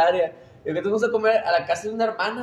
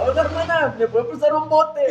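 retold shout; 8 semitones higher, 4 dB louder than usual. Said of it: "Y luego él grita".

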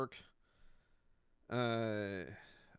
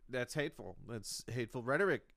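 usual speaking voice

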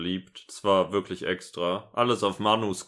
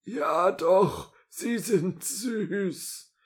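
very high, drawn-out coo; 11 semitones higher, much slower than usual. Acted. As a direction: coo like an adoring granny. Second voice cooing over couple.

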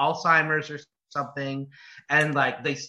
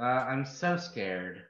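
deadpan mock fear; 4 semitones lower, 9 dB quieter, much slower than usual. Sarcastic reply to monster movie.

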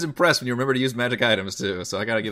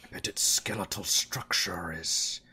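put-on very old man; 7 semitones lower, 6 dB quieter, slower than usual. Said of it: "He's like".

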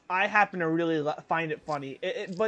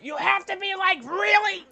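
much higher, strained mock screech; Gilbert Gottfried voice; 13 semitones higher, 5 dB louder than usual. Friend attempting Gilbert Gottfried, high strained screech. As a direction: squeeze it up into a screechy yell. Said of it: "It's so hard 'cause".

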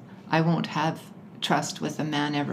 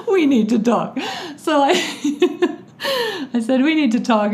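louder, higher, cracking up mid-quote; laughing; 8 semitones higher, 4 dB louder than usual. Real, not acted. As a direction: repeat the dreaded words through laughter. Cracking up at her own quote.

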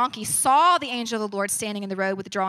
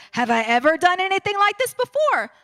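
high, pleading donkey, much higher; 6 semitones higher than usual. Acted. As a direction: squeak it out as the wronged donkey. Donkey answers Balaam in story.

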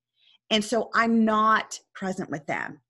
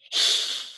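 angry huff. Retold or imitated. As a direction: huff like a mad little boy. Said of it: "So he goes like this".

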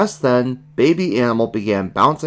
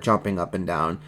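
usual speaking voice

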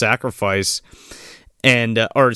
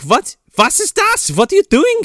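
much higher, outraged German teacher; shocked German teacher; 5 dB louder than usual. Retold or imitated. German teacher catches Clint at the altar, shrill outrage. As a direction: shriek it like a scandalised German teacher. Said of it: "the German teacher walks in, he's like".

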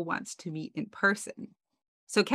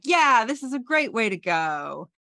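higher, louder, put-on enthusiasm; mock cover-up; 5 semitones higher, 5 dB louder than usual. Embarrassed passenger to cab driver, mock enthusiastic cover-up. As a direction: overdo the casual chirp to cover up.

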